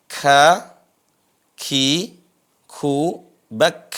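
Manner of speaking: "Ka, ki, ku, bak" with a puff of air on each k; aspirated kaf. Shown as a demonstration. Teacher drills kaf one syllable at a time, each k breathed out. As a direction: let the k hiss in "Ka, ki, ku, bak". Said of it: "ada desisnya".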